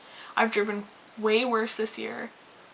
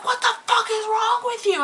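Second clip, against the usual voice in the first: screaming at her; 11 semitones higher than usual. Retold or imitated. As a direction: demand it of her at full fury, pitch high. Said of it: "So I am screaming at this woman".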